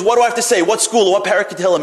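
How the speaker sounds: high, loud run of questions; eager questioning. Retold or imitated. Woman asking the rabbi what to recite, eager questioning. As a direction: fire off questions, ready for anything.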